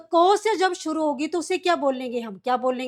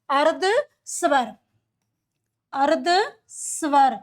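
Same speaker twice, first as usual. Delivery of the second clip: slow dictation; much slower than usual. Shown as a demonstration. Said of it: "क्या बोलते हैं बच्चों?".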